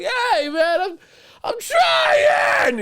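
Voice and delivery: very high, loud yell of trying; frustrated shout. Retold or imitated. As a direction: shout back that you're trying. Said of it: "and I'm like".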